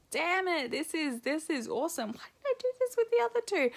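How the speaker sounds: high-pitched mock exasperation; amazed exclaim. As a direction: rue the hard labours in mock dismay. Retold thought, amazed at ease.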